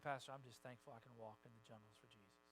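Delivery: grateful church lady, soft. Retold or imitated. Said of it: "she said".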